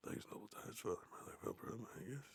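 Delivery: reading the play in a whisper. Real, not acted. Reading Titus to listeners with a sore throat, whispering. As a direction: hush it, read it whispered. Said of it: "I like talking quieter".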